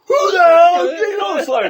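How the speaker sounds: high, loud laughing shout; furious teacher shout. Retold or imitated. Teacher finding the salami gone, high loud laughing shout. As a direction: shout it like a teacher robbed of his food.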